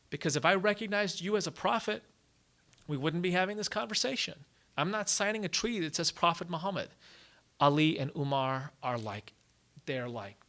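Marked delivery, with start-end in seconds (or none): 0.1-6.9 higher-pitched, argumentative objection; stubborn refusal. Acted. Meccan leader refusing to sign the treaty, stubborn. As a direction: argue back and refuse outright.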